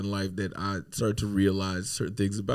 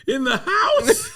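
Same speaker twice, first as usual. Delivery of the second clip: put-on much higher, slow, laughing kid question; surprised son; 6 dB louder, slower than usual. Son hearing they'll shoot arrows indoors, high startled question. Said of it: "And he goes".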